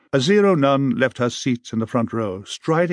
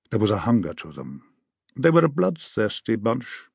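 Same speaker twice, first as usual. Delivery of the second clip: ordinary delivery, nothing marked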